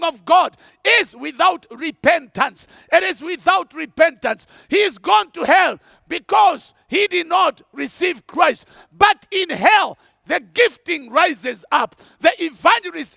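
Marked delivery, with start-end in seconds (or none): none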